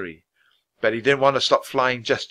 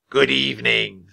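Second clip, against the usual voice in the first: Raven King voice. Acted. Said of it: "said the Raven King".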